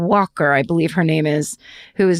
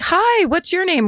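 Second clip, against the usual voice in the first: high, drawn-out friendly hello; retold bright greeting; 10 semitones higher, slower than usual. Woman greeting the stranger outside her window, high drawn-out greeting. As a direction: call a hello up high, stretched out.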